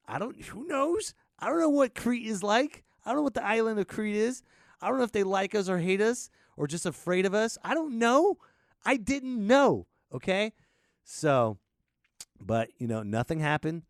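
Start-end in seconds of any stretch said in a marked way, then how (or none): 0.1-10.5 much higher and louder rising panic; high, loud panicky rambling about the danger; panicked. Retold or imitated. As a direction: spiral into frantic worry. Freaking out next morning.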